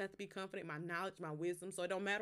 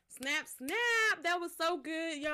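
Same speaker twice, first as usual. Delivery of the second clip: much higher and louder, delighted snaps; excited cheer; 9 semitones higher, 8 dB louder, much slower than usual. Real, not acted. Praising her own poem to viewers, loud excited cheer. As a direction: hype up your own poem.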